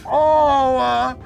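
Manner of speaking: hurt and whining; pained groaning. Acted. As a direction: groan through a broken nose. Harry punched in the nose.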